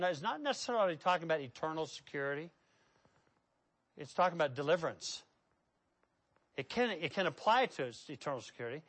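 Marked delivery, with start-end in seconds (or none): none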